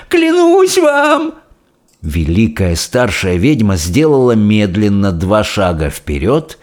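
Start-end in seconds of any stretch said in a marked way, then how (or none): 0.1-1.3 slow, very high pleading wail; terrified. A narrator's reading. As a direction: draw out the oath in terror. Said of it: "Она уже дрожала от страха".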